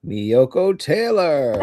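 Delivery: slow, drawn-out announcer name call; cheesy announcer. Pretend. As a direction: announce the name like a game show host. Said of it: "I'm always gonna do that, sound like a cheesy announcer".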